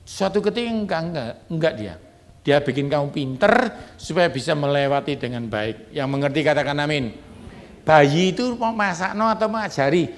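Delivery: ordinary delivery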